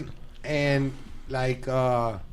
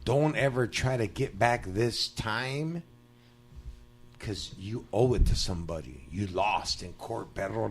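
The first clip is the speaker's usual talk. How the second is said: imitating a fellow inmate; 6 semitones lower, 9 dB quieter than usual. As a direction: counsel a homie quietly, with long pauses. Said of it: "this vato goes".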